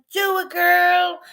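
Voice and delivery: high, drawn-out cheer; eager urging. Retold or imitated. Acting out her eager reply.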